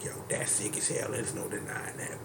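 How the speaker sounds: awed whisper; whispered awe. Retold or imitated. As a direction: breathe it out in hushed awe. Quoting own quiet reaction.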